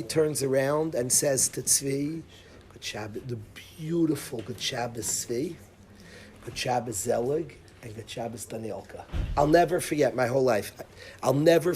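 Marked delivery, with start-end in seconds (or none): 2.7-9.0 much softer, gentle Good Shabbos; soft farewell. Retold or imitated. Rabbi wishing the boys Good Shabbos, hushed and tender. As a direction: let the anger fall away into a blessing. Tense farewell after screaming.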